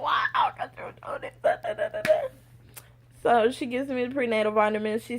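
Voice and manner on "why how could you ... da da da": frantic whisper trailing into babble; mock whispered lament. Patient questioning her situation once alone, mock anguished whisper. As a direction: whisper a hammed-up "why me" complaint.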